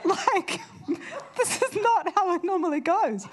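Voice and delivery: laughing. Real, not acted. Sheepish preacher laughing at her own blunder. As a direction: laugh through the embarrassed admission.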